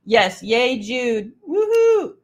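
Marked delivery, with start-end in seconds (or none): 1.5-2.2 cheering woohoo. Real, not acted. Cheering for Jude.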